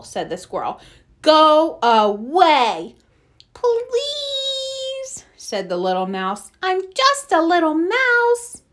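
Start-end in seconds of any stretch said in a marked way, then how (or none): 1.2-3.0 slow, loud dismissal; firm refusal. Acted. Animals send the little mouse off, emphatically dismissive. 3.5-5.2 very high, long drawn-out squeak; teeny tiny plea. Acted. Little mouse begs once refused, tiny drawn-out pleading. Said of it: "in a teeny tiny voice". 6.6-8.6 high, pleading mouse voice; teeny tiny mouse. Acted. Little mouse pleads with the four animals, tiny voice. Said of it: "in a teeny tiny voice".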